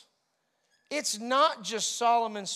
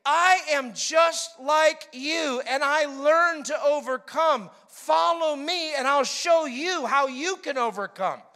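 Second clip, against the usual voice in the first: loud, slow, emphatic sermon read; a slow, booming scripture read; preaching scripture; 4 semitones higher, 7 dB louder, slower than usual. Acted. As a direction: proclaim each phrase to the congregation. Jesus's words in preaching voice.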